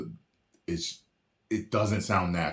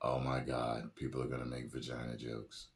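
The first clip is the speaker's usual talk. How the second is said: low, very soft retold groaning thought; muted dismay; 8 semitones lower, 13 dB quieter than usual. Reactor recalls dreading the jokes, quietly dismayed. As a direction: recall the reaction under your breath.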